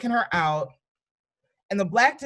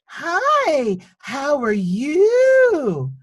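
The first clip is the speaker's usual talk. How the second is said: much higher, very slow, stretched-out greeting; sing-song teacher; 8 semitones higher, 6 dB louder, much slower than usual. Retold or imitated. Kindergarten teacher greeting a new pupil, high and drawn out. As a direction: talk down to a small child like a sugary teacher. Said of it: "the teacher leans down and she goes".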